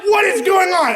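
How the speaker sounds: high, loud startled yell; shocked shout. Retold or imitated. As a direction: blurt it out in total shock. Shocked at stranger in dorm.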